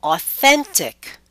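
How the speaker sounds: slow "authentic" with THEN high and strong; stress before suffix. Shown as a demonstration. Teacher shows the -ic rule, "authentic" stressed on THEN. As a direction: punch THEN in "authentic", taking it slowly. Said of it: "we stress immediately before".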